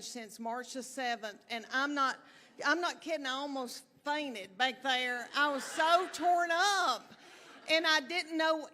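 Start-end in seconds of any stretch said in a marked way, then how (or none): none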